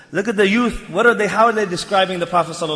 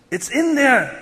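ordinary delivery

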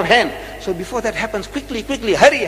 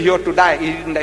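ordinary talk, nothing marked

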